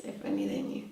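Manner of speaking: low, soft, trailing repeat; quiet afterthought. Real, not acted. Guest musing on what people miss, quiet afterthought. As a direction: let it sink down quietly.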